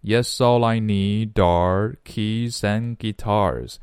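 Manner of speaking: "yes, all I" slowly run together; slow, joined-up "yes, all I need are keys and guitars"; linked speech. Shown as a demonstration. Teacher shows students linking, slow and joined from "yes" to "guitars". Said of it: "连起来".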